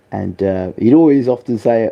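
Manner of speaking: usual speaking voice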